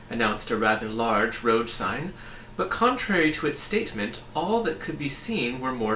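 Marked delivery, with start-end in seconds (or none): none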